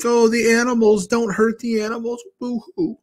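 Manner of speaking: high, sappy caricature ending in a fake boo-hoo; mocking soy boy whine. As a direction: ham up a weepy animal lover. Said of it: "a soy boy and be like".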